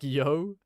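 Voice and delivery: a quick loud greeting. Retold or imitated. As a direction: bark out a short yo. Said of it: "It's like".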